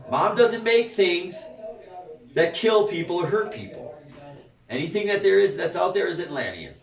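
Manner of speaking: usual speaking voice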